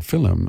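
"film" imitated as fill-um; borrowed Irish "fillum" for "film"; Irish two-syllable pronunciation. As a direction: break "film" into two beats, FIL-um. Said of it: "as film with two syllables".

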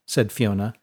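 ordinary delivery, nothing marked

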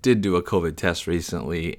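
ordinary talk

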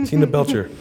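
laughing through the name; amused, giggling as she says it. Real, not acted. Laughing at own name slip.

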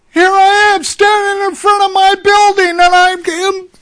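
very high, loud, astonished; can't believe it happened. Retold or imitated. Mafia man describing kneeling at his building, astonished.

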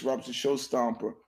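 ordinary talk, nothing marked

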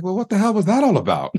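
high-pitched retold astonishment; incredulous, voice jumping way up; baffled surprise. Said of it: "And I was like".

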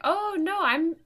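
high, loud, defensive protest; retold flustered self-defence. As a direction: protest earnestly, pitch leaping up on the first words. Retold defence to angry stranger.

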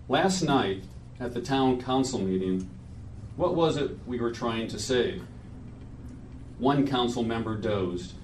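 measured poetry reading at a memorial; solemn reading. Acted. Reads his friend's poem aloud.

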